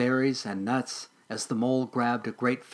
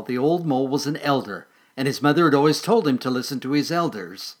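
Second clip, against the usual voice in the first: ordinary delivery, nothing marked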